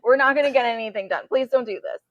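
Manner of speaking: hammed-up frantic parent plea; panicked pleading. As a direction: beg a child not to cry, words tumbling out. Acted plea to crying daughter.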